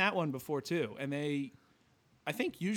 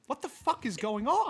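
outraged disbelief; 8 semitones higher, 3 dB louder than usual. Retold or imitated. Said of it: "you really are like".

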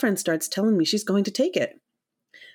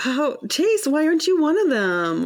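mock indignant; 8 semitones higher, 3 dB louder than usual. Mocking the guy.

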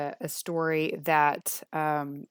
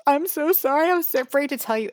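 put-on high, louder, rushed child's apology; upset little girl; 10 semitones higher, 7 dB louder than usual. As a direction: play the scared child blurting it out fast. Tearful daughter confession, voiced by mother.

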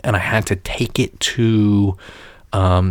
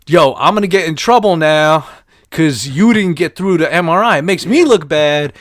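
annoyed technician; 6 semitones higher than usual. Retold or imitated. Hammed-up technician scolding the patient who quit. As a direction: play the tech griping at the patient. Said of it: "They're like, they're like".

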